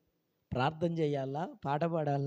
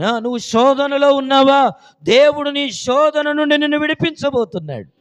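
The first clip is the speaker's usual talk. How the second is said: louder and higher with each promise; building sermon promise; 8 semitones higher, 13 dB louder than usual. Real, not acted. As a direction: start firm, rise to a high shout. Preaching, building in force.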